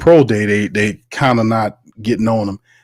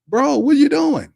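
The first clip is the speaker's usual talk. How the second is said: mock disbelief. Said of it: "He was just letting it go".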